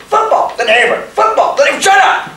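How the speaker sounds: shrill yelled argument, football against the neighbor; two voices in his head screaming at each other; shouting match. Acted.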